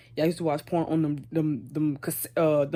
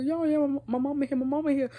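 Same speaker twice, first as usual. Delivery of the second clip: higher, urgent alarm; panicked warning; 9 semitones higher than usual. Retold or imitated. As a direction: blurt it out in a panic. Friend warns mom is home.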